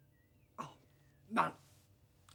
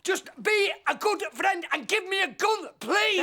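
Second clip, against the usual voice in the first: desperate shout; 6 semitones higher than usual. Acted. Man demanding a gun from his friend, shouting. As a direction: beg until it breaks into a yell. Desperate plea building to outburst.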